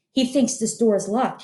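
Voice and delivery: ordinary delivery, nothing marked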